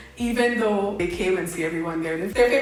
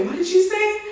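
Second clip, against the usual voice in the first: high-pitched mock challenge; confrontational retort; 12 semitones higher than usual. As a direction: imitate her, pitched way up, spoiling for a fight. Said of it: "She allowed it up".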